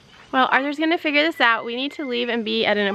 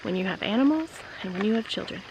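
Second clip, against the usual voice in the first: fading to a near whisper; quiet; 2 semitones lower, 9 dB quieter than usual. Real, not acted. Softly repeating a life lesson.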